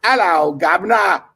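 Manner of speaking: loud put-on British greeting; British accent. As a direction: shout it like a stage Englishman. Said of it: "Is he British?".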